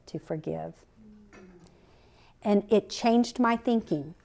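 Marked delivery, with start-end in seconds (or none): 0.0-0.7 soft, gentle voice of the Lord, much quieter; gentle hush. Retold or imitated. The Lord gently speaking to [name] in her car, soft and hushed. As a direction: speak it softly and tenderly. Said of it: "so gently whispered to me".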